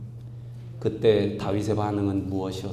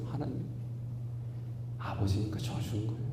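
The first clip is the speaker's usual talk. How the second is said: normal talking voice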